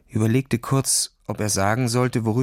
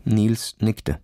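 usual speaking voice

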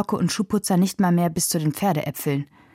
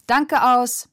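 raised, higher calling voice; director's call; 6 semitones higher, 3 dB louder than usual. A narrator's reading. Director calls cut to his actors, calling out. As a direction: call it out across the set. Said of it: "ertönte Vincents Stimme".

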